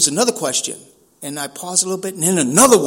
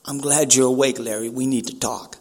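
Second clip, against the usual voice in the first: low, softer talking vulture; lightly voiced looming vulture, pitched down; quietly ominous vulture, low; 7 semitones lower, 3 dB quieter than usual. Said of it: "the vulture says".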